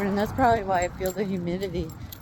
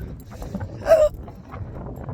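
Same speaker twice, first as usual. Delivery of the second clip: hiccup gasp. Real, not acted. Hiccup gasp mid-story.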